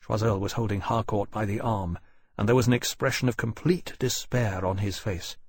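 ordinary delivery

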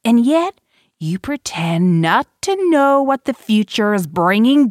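furious accusation. A narrator's reading.

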